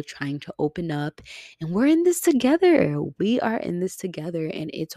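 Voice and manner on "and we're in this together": much higher and louder, bursting with feeling; emotional outburst. Real, not acted. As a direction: cry it out to lift everyone up. Rallying listeners at the end.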